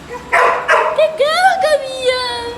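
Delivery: loud, high pet praise; praising a pet. Real, not acted. Praising and calling the animal.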